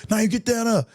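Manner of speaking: shrill-free high mock warning from mother; worried mom. Mom scolding again.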